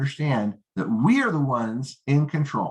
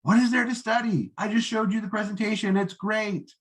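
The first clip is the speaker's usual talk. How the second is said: much higher, insistent hype; pushy novice; 8 semitones higher than usual. Shown as a demonstration. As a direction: brush off their doubt eagerly. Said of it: "the novice would say".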